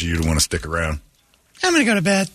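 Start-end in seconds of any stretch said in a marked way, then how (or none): 1.6-2.3 high mock wife voice. Wife voiced going to bed.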